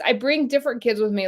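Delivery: usual speaking voice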